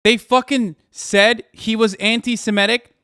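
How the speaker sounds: ordinary talk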